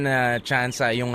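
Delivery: ordinary talk, nothing marked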